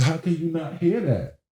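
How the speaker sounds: high, baffled disbelief; can't believe she missed it; disbelieving. Retold or imitated. Husband baffled that his wife heard nothing, high disbelief. Wife stares like he's crazy.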